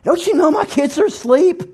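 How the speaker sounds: exasperated, high-pitched scolding; acted outrage, pitch jumping up without full volume; mock indignant yell. Woken parent yelling at neighbor through intercom, mock indignant. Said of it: "yell at him through the intercom".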